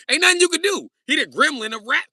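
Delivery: high, loud, emphatic proclamation; excited comic rant. Real, not acted. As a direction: proclaim it with high-pitched conviction. Comic rant about rapper.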